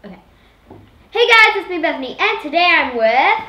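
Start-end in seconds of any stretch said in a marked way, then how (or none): 1.1-3.4 louder, bright YouTube intro voice; energetic vlog intro. Shown as a demonstration. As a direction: pump up the intro like a host. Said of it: "I'm just gonna be like".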